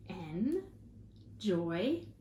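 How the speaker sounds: slow, syllable by syllable; split into syllables. Shown as a demonstration. Teacher modelling syllables for the class, slow and chopped. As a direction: chop each syllable out slowly. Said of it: "Let's try enjoyment".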